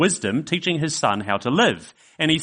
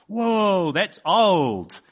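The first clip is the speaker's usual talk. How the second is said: put-on higher childlike whoa, wide-eyed; amazed little boy; 7 semitones higher, much slower than usual. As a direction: marvel like a small kid. Child voiced in story.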